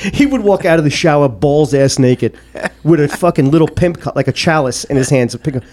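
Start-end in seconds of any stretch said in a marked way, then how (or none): none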